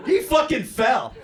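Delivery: high, loud, drawn-out exclamation; shouted climax. Retold or imitated. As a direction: cry out the disaster slowly. Climax of the fall story.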